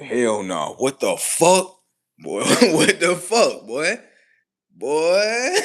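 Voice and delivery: loud, high, drawn-out outrage; shocked disbelief. Acted. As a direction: stretch the disbelief out, rising to a cry. Acted quote, shocked reaction.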